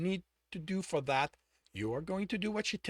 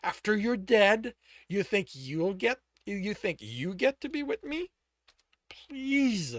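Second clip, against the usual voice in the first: slow, scornful questions; disdainful mockery; 5 semitones higher, 5 dB louder, slower than usual. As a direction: sneer at the promise. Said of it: "disdain in his voice".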